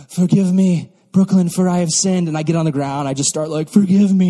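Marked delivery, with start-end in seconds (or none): 0.1-2.3 loud, hammed-up begging; mock weeping plea. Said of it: "I'm, like, sobbing and weeping". 3.7-4.3 loud, overdone wail for mercy; hammed-up penitent cry; mock sobbing plea. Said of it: "I'm, like, sobbing and weeping".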